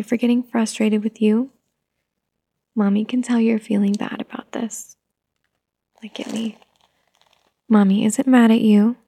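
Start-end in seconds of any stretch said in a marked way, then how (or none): 2.8-6.6 soft soothing whisper. Acted. Mother comforting her guilty toddler in a whisper. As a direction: breathe it gently to calm.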